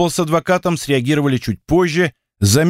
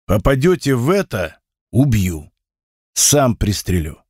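menacing threat; 4 semitones lower than usual. Acted. Boss threatens his deputy.

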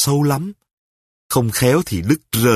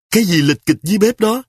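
much higher, louder, fast bark toward the kitchen; shouting; 8 semitones higher, 3 dB louder, faster than usual. Acted. A father shouts from the bed at noises in the kitchen. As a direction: yell down to the kitchen. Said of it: "Tôi quát to".

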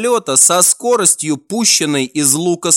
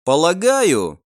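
ordinary delivery, nothing marked

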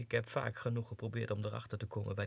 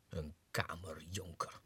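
slow, soft contemptuous whisper; scornful whisper; 7 semitones lower, 6 dB quieter, slower than usual. Acted. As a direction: hiss the title with disdain. Scornful aside. (minachtend gefluisterd)

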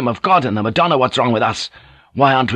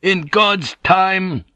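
very slow, high, weighty answer; outraged retort; 9 semitones higher, much slower than usual. A narrator's reading. Superior priest answering open rebellion, outraged. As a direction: hammer out each word with righteous anger.

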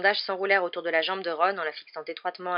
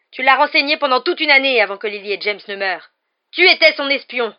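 loud, high shouted accusation; furious accusation; 7 semitones higher, 14 dB louder than usual. Acted. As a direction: rage at the traitor. (accusation hurlée)

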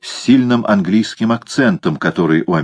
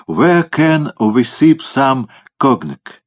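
American English; 6 semitones higher, much slower than usual. Acted.

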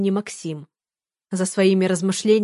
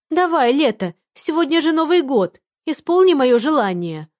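high pleading mimicry, louder; squeaky mocking voice; 7 semitones higher, 4 dB louder than usual. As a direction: parody his whiny begging. She mimics the boy's plea again.

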